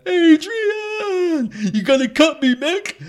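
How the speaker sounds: very high, loud strained Rocky Balboa voice; a hammed-up punch-drunk Rocky; Rocky impression. Quoting Rocky to his cohost, a high, strained mock Rocky voice. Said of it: "everyone was cheering for Rocky".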